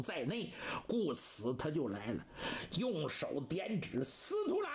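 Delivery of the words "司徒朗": angry call. Acted. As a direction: shout the name, much higher. Enraged opponent calls out name.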